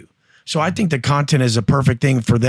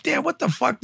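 much higher and softer, spooked; hushed alarm; 11 semitones higher, 5 dB quieter than usual. Retold or imitated. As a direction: breathe it out, suddenly nervous. Inmate alarmed by sharpening blade.

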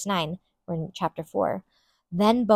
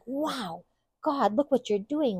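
amazed wow; 5 semitones higher, slower than usual. Retold or imitated. Boaz amazed, voiced in retelling.

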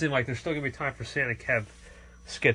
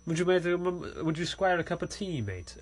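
higher, soft imitation British accent; light mock-British lilt, trailing softer; 5 semitones higher, 6 dB quieter than usual. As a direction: tease with a quick put-on British voice. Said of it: "in that British accent".

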